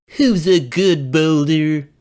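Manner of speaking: high, slow coaxing pet talk; baby talk to a pet. A narrator's reading. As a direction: talk to it like a good dog. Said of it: "patted the large rock, asking it".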